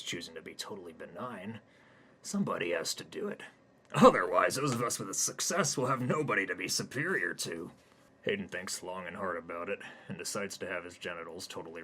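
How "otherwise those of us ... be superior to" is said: loud, smug doctor voice; gloating doctor. Acted. Doctor gloats over Hayden, loud and smug. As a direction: lord it over him.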